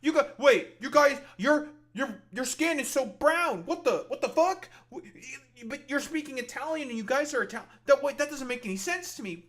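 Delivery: high, loud panicked mockery; mock freaked-out tourist. Streamer mocks the vlogger seeing brown Italians, high and loud. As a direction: squeal like a shocked bigot. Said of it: "Be like".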